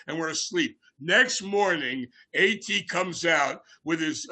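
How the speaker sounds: ordinary talk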